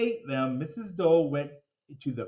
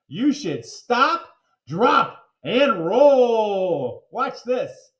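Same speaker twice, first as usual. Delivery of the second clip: emphatic instruction; 5 semitones higher, 8 dB louder, much slower than usual. A narrator's reading. Officer demonstrates fire safety.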